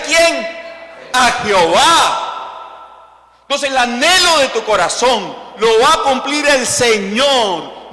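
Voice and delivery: loud, high, stretched-out proclamation; full-force preaching. Real, not acted. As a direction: drive the point home from the pulpit.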